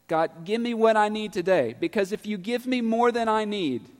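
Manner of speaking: impassioned pulpit prayer; fervent preached prayer. Acted. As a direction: cry it out to God.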